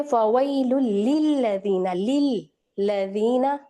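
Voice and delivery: लिल्लज़ीना slowly with two l sounds; slow doubled l. Shown as a demonstration. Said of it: "दो लाम निकालेंगे".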